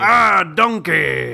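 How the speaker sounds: loud slow ogre call; Shrek voice. Pretend. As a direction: bellow donkey like the ogre. Shrek impression.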